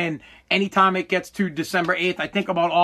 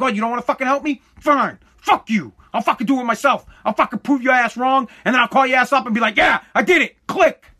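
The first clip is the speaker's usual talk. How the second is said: higher, louder, fast mock rant; fired-up defiance; 5 semitones higher, 4 dB louder than usual. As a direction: spit it out, fired up to prove him wrong. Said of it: "I'd be more like".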